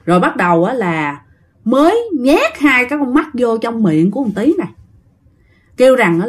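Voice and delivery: plain reading voice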